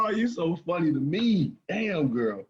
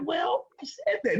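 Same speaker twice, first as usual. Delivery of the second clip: mock sweet protest; 10 semitones higher than usual. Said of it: "She like".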